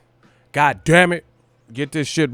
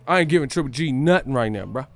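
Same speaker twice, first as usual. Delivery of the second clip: ordinary delivery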